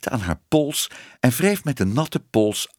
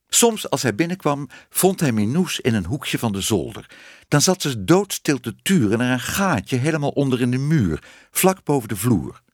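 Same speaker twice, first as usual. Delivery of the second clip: ordinary delivery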